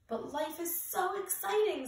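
high, eager pleading; bursting with excitement; excited. A narrator's reading. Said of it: "said Clark".

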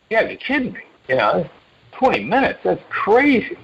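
high, incredulous disbelief; can't believe twenty minutes; disbelieving. Retold or imitated. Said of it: "I can remember thinking".

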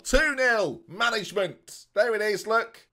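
high, loud triumphant call; goal celebration. Real, not acted. Streamer celebrating two nil, high triumphant shout.

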